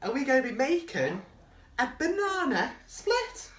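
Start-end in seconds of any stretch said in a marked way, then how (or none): 1.8-3.5 excited. Real, not acted. Excited reveal to child.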